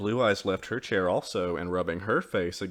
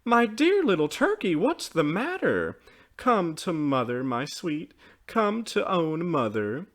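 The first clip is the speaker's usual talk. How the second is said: high, concerned motherly outpouring; exclaiming tenderly; 7 semitones higher, 5 dB louder than usual. A narrator's reading. Mother comforts her tearful child, exclaiming tenderly. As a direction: coax a tearful child over, voice raised. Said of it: "she exclaimed".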